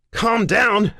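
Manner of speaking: high, loud, incredulous scream. Acted. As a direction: scream it back at the operator in disbelief. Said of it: "I scream at the lady".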